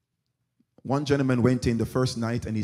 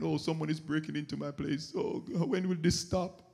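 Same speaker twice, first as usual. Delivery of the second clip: mock whining; 5 semitones higher than usual. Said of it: "sit there, start crying".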